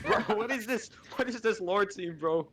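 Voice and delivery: high giggly disbelief; laughing through the words. Real, not acted. Laughing at absurd twist.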